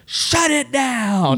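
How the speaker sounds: slow, drawn-out high command; commanding order. Retold or imitated. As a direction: bark the order like a cop in charge. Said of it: "jump up on the platform and go".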